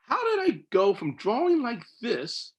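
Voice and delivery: playful high teacher prompt; teacher quizzing kids. Retold or imitated. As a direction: lead the children toward the answer. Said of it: "and I say".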